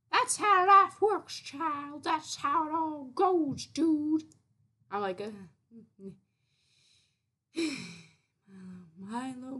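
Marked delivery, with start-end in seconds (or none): 0.1-4.2 higher, louder, slow know-it-all drawl; hammed-up guru cat; slow sage cat voice. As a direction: lecture the kid like a wise old cat on catnip. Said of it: "He's like".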